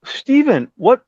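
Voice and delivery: high, baffled question; retold bewilderment. Bassist finding Steven at the studio, bewildered. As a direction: act out being thrown by who is there. Finds the singer there unexpectedly.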